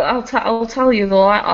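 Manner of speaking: ordinary delivery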